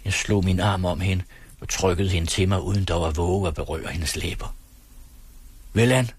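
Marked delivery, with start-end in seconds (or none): 5.7-6.1 louder, higher exclamation; a short, raised cry of resolve; calling out. A narrator's reading. Lover agrees to Laura's condition, calling out. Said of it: "råbte jeg".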